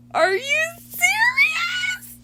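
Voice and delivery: high, slow, squealing disbelief; screaming with real delight; excited shriek. Real, not acted. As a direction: shriek it out in pure joy. Thrilled at a thousand subscribers.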